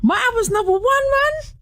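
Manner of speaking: high, squealing delight; giddy disbelief. Real, not acted. To the interviewer, squealing that the album is number one. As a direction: burst out in giddy amazement.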